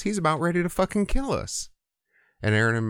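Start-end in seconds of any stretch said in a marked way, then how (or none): 0.0-1.7 a hammed-up frantic complaint, much higher; panicked. Overseers panicking to Moses about Pharaoh, mock frantic. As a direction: voice shooting up high and fast in mock panic.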